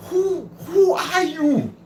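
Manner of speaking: high, slow mocking question; half-laughing, drawn-out mock challenge; squeaky laughing who are you; mock incredulous asking. Mocking the self-questioning mindset.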